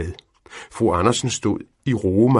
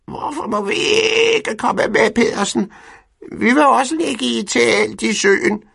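very high, squawking hen voices; clucking hens; 8 dB louder than usual. A narrator's reading. Hens complaining to Pedersen they want to come, very high. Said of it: "kavlede hønsene".

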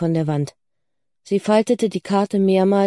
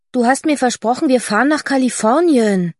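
higher-pitched reproachful complaint; indignant protest; 5 semitones higher, 4 dB louder than usual. Acted. Daughter protesting the cancelled California trip, indignant. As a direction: hold mother to the promise, voice raised in pitch. Girl protests broken holiday promise.

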